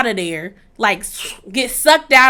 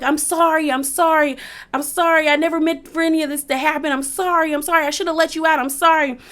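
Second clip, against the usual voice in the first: higher-pitched pleading, repeated insistently; frantic apologizing; 5 semitones higher, faster than usual. Retold or imitated. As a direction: imitate someone begging for forgiveness. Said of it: "trying to apologize".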